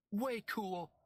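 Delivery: put-on high, bright kid exclaim; a girl thrilled by the spectacle; excited exclaim. A narrator's reading. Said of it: "Penny exclaimed".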